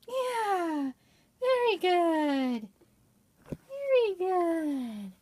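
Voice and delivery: high, slow, drawn-out praise. Real, not acted. Mother praising the cat, slow and high.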